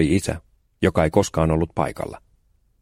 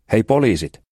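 put-on boy calling out; 5 semitones higher, 4 dB louder than usual. Boy calls out to police officers.

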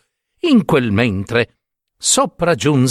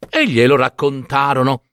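usual speaking voice

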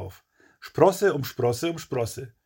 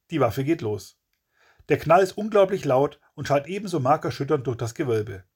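ordinary delivery, nothing marked

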